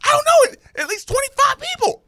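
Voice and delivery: high, loud, fast falsetto of the exasperated mom; the mom blurting it out in distress; upset mom. Retold or imitated. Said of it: "She's like".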